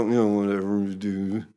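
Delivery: low, indistinct grumble trailing into a blur; mock mumble. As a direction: mumble it so nobody can catch the words. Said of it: "they mumbled it".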